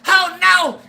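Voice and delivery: high, loud shocked neighbor call. Retold or imitated. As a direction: holler over the fence, scandalized. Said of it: "I heard my neighbor going".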